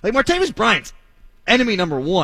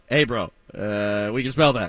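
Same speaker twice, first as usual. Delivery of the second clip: laid-back dog voice; 7 semitones lower, 4 dB quieter than usual. Retold or imitated. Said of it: "drug-sniffing dogs were like".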